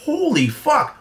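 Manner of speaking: high, stunned gasp of a curse; shocked disbelief. Retold or imitated. Retelling his reaction to the mother's slur, stunned and high. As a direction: recoil in horror at the words. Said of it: "I'm like".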